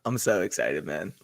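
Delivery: ordinary talk, nothing marked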